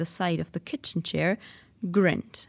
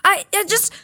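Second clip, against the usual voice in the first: put-on stammering boy; 14 semitones higher, 7 dB louder than usual. Harry stammering.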